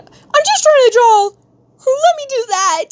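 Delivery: loud, squealed whiny plea; high-pitched protest. Real, not acted. Mock protest to chat.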